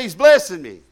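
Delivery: loud call for the church to repeat; congregation call. Shown as a demonstration. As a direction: belt it out for them to echo. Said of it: "Somebody say".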